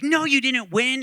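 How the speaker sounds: acted-out angry outburst. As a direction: shut the kid down, high and loud. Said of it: "he's, like, angry".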